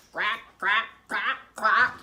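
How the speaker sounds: lightly voiced duck quacking; duck cry. Ping cries out as the boy grabs him, mild duck squawk. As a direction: crack it out like a frightened duck. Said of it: "cried Ping".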